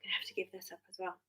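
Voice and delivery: fast, hushed whisper of defeat; resigned rapid whisper to herself; despairing whisper. Retold or imitated. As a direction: breathe it out quickly like giving up. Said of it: "And I was just like".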